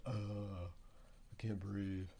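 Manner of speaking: slow, strained mouth breathing; gasping mouth voice. Pretend. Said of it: "my mouth is like".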